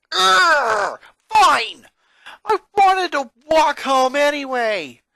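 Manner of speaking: loud, high frustrated shout with a groan; boy shouting at nobody after missing his bus; angry yell. Acted. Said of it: "Lincoln yelled to no one in particular".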